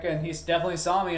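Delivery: ordinary talk, nothing marked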